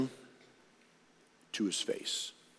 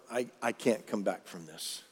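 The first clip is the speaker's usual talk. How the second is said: despairing; 4 semitones lower, 7 dB quieter than usual. Acted. Acts Peter despairing after bitter weeping.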